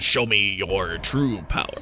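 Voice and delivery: low, slow, hammed-up boss voice; a cheesy game boss, dropped low; cheesy villain line. Gamer repeating the poorly voiced line, low mock boss voice. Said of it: "that particularly poorly voiced line".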